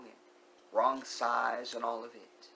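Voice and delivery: ordinary talk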